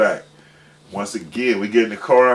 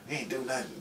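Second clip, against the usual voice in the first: normal talking voice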